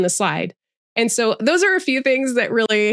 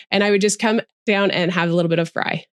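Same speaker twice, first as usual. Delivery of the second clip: ordinary delivery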